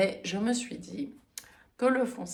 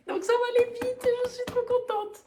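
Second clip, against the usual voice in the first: much higher and quick, bursting with delight; excited; faster than usual. Real, not acted. Knitter telling listeners her fast project, excited squeal. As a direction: squeal it out, thrilled. Thrilled about fast chunky knit.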